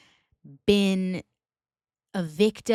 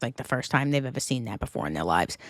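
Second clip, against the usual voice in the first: ordinary delivery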